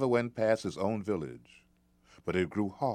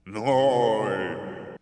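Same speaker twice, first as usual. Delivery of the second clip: giant voice; 3 semitones higher, 7 dB louder than usual. Acted. Giant speaks.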